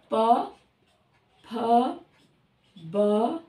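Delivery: slow recital. Shown as a demonstration. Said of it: "इसका पांचवा स्थान म आ रहा है".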